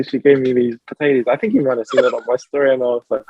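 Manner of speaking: usual speaking voice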